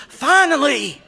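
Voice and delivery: very high, loud cry of relief; exultant, full-voiced yell; overjoyed shout. A narrator's reading. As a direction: shout it to the heavens, elated. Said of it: "clear display of emotion".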